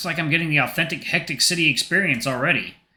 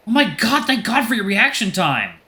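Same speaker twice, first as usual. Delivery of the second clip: shaken relief; 8 semitones higher, 4 dB louder than usual. Acted. Passenger after near crash.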